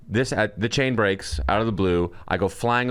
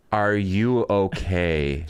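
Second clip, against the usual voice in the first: slow, drawn-out question at normal pitch; insistent concerned girl; much slower than usual. Retold or imitated. Girl checking on the injured cyclist, slow deliberate concern. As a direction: stretch each word, imitating a worried onlooker. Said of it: "And then some girl's like".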